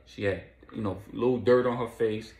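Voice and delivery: ordinary delivery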